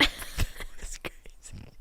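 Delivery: slow breathy whisper of mock amazement; slow mock-awed whisper; whispered awe. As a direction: hush it out slowly, wide-eyed. Mock awe at dissociation talk.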